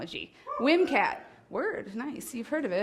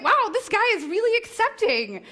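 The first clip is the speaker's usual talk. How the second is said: higher, louder, laughing amazement; amazed aside; 10 semitones higher, 5 dB louder than usual. Retold or imitated. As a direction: marvel at him through a laugh. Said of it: "I'm like".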